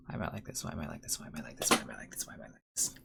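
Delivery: whispered, muttered self-reproach; hushed self-reproach. Real, not acted. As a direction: mumble it under your breath to yourself.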